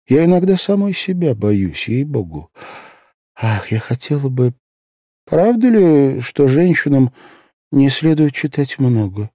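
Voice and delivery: a lightly voiced anxious young girl; young girl's voice. A narrator's reading. Asya's voice in narration.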